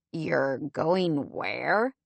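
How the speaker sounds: slow, pointed mock demand. A narrator's reading. Monty to Lindsay about her trip, a slow irritated demand. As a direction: press her for an answer, slowly. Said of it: "Monty demanded".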